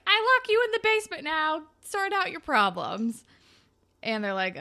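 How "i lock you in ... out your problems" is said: fast, higher, louder mock threat; a hammed-up captor announcing it quickly; mock villain. Casey's captor locks them in the basement, fast mock threat. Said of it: "he's like".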